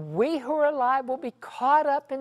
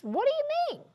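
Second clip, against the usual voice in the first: incredulous question. Retold or imitated. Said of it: "And she says".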